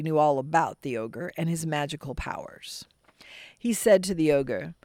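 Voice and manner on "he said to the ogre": usual speaking voice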